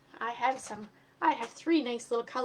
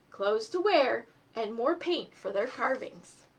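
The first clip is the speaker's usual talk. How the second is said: put-on lively read-aloud voice for children; story narration; 2 semitones higher, 6 dB louder than usual. A narrator's reading. Teacher reading a storybook to children, lively. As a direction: tell the tale to a class, animated. Reads a picture book aloud.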